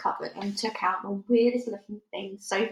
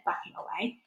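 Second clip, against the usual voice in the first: ordinary delivery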